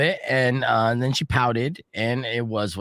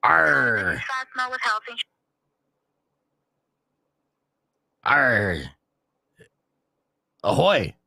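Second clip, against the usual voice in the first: gruff pirate growl into the phone; much slower than usual. Pretend. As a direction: growl like a pirate at a spam caller. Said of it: "Talk Like a Pirate Day".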